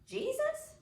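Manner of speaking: very high, startled guess; puzzled kid. Acted. Claude guessing at rehearsal, high and unsure. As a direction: toss it out as a wild, baffled guess.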